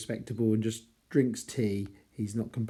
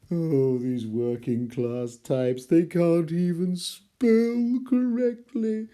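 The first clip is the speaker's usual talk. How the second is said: much higher, louder, slow snobbish scorn; mock snob; 5 semitones higher, 4 dB louder, slower than usual. Teacher mocks snobs scorning working class spelling, in a hammed-up sneer. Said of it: "There's a bit of that going on here".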